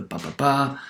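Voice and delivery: "ba, ba, ba" in syllable rhythm; stress pattern demo. Shown as a demonstration. Lecturer repeating the pattern, "ba, ba, ba" in rhythm. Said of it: "this one O O stress pattern".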